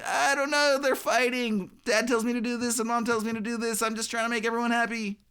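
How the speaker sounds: put-on high, sing-song overwhelmed child; fretful little kid. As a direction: whine like a kid caught between arguing parents. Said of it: "like, some little kid in between mom and dad".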